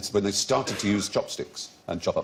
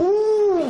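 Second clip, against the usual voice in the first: high, loud ooh of wonder; delighted ooh; 8 dB louder than usual. Real, not acted. Host seeing the substance light up, delighted ooh. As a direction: coo loudly, pitched way up. Marvels at substance glowing under ultraviolet.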